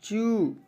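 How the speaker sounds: चू stretched slowly on its vowel; चू held, falling at the end; drawn-out ū. Shown as a demonstration. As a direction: pull the ऊ of चू long. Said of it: "पढ़ेंगे खींच के".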